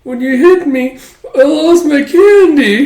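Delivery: put-on high, loud, slow whimpering kid; sulky child. Son complaining to his father after being smacked, high sulky child. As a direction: complain like a hurt little boy.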